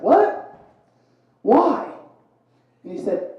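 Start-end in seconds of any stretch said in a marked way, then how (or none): 0.0-1.9 What? Why? pitched much higher; startled, high question; mock surprise. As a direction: sound caught off guard. Shocked at brother's remark.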